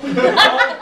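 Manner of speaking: cracking up mid-sentence; laughing while talking. Real, not acted. Laughs at oiled-up headshots.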